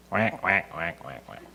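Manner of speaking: soft imitated quacking of distant ducks; duck quacks.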